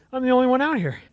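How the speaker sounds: ordinary talk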